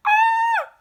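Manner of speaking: loud high-pitched scream of fright; startled scream. Retold or imitated. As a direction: shriek like you just got scared. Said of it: "Even David's like".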